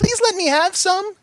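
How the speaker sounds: high, slow begging pony voice; pleading Pinky. A narrator's reading. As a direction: smeek het hoog en traag als een klein pony'tje.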